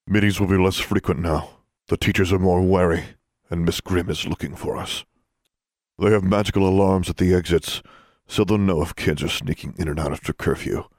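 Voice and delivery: a hint of a stern leader; stern older student. A narrator's reading. John voiced lower.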